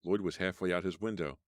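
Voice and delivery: usual speaking voice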